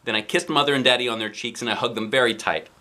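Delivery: ordinary delivery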